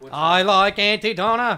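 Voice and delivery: slow Kennedy-style voice; drawn-out, put-on Kennedy delivery; a friend showing off his Kennedy; Kennedy impression. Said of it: "I'm doing Kennedy now".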